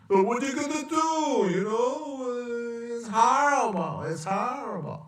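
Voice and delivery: high mock whine; mocking Trump. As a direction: parody the president's whining. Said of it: "He even has that stupid accent".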